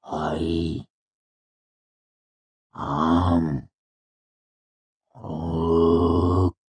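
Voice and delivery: drawn-out words with long gaps; dazed mercenary labouring over each word; very slow. A narrator's reading. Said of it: "he said slowly".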